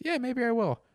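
high put-on voice, lightly imitated; Patrice. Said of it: "And he goes".

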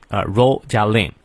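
usual speaking voice

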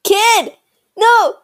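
calling out. Pretend. Calling after the kid again.

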